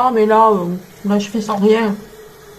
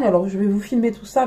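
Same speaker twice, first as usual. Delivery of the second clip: ordinary delivery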